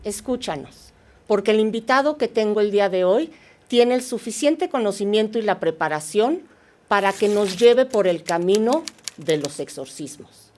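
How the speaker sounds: soft, measured mystery-show introduction; podcast intro. Acted. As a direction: draw listeners in with hushed, deliberate phrasing. Host reads the show's introduction. (introducción pausada y en voz baja de programa de misterio)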